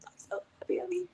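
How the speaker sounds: high, tearful whisper, rushed; secretly emotional. Retold or imitated. Wrestler confessing her soft inside after acting hard, tearful whisper. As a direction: squeak it out, mock crying under your breath. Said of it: "But I'm in this inside".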